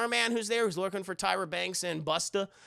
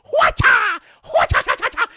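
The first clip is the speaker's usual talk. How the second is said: loud, much higher Bruce Lee battle cry; kung fu shout; 14 dB louder than usual. Retold or imitated. Imitating Bruce Lee while watching his films, loud kung fu yell. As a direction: yell it like a martial arts kick. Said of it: "doing hwa-cha sound effects".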